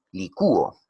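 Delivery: "licúo" with a stressed, separate ú; demonstrated hiatus. Shown as a demonstration. Teacher showing pupils how the closed vowel sounds in "licúo", stressed hiatus. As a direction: lean on the ú in "licúo". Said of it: "qué pasaría si yo pronuncio".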